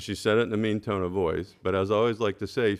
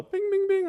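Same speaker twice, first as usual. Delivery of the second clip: high sing-song bing bing of a bike bell; bell imitation. Board member mimics his bike bell to the board, sing-song. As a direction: chime it like a little bell.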